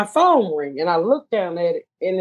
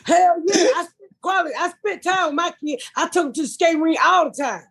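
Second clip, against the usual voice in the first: pitched-up defensive ex; high mock ex voice; 9 semitones higher than usual. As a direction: play him high and defensive. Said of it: "He said".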